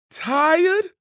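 high, loud mocking squeal. A narrator's reading. Coach squeals back at the tired runner. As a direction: squeal the word back at him. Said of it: "Coach squealed".

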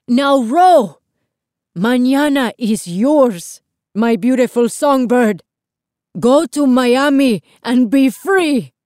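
high, loud, drawn-out farewell; urgent, heartfelt projected call; yelling back. A narrator's reading. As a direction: shout your blessing across the water. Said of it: "Lito yelled back".

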